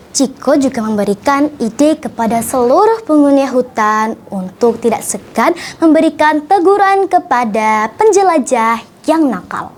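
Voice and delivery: usual speaking voice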